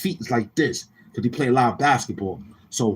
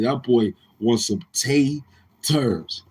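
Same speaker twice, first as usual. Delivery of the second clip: usual speaking voice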